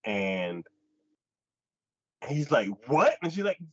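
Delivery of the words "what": loud startled what; a sharp startled what?; mock startled what; shocked what. Retold shocked reply.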